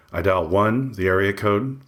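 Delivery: ordinary delivery, nothing marked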